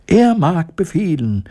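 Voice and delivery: high mouse call; calling mice. A narrator's reading. Mice answering their queen in chorus, high call. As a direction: pipe up obediently as the mice. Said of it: "riefen die Mäuse im Chor".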